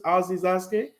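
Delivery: ordinary delivery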